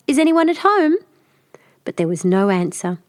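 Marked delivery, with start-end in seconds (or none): 0.1-1.0 high calling out at the door. Acted. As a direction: call into the empty house, raised and high.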